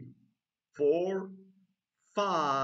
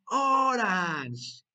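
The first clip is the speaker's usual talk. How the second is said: loud, high, long "orange"; big drawn-out "orange"; model for repeating; 4 semitones higher, 6 dB louder than usual. Shown as a demonstration. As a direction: call out "orange" for the class to echo. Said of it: "repeat after me".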